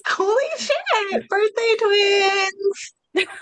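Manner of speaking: very high, drawn-out excitement; thrilled squeal. Real, not acted. Learning co-host shares a birthday, thrilled squeal. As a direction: squeal it slowly, stretching each word.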